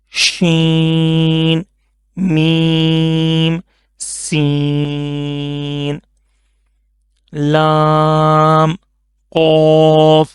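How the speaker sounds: stretches each letter name slowly for the class; slow letter recitation. Shown as a demonstration. Teacher reciting letters slowly to pupils. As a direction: read the alphabet like a patient teacher. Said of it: "teacher reading Qaida letters aloud".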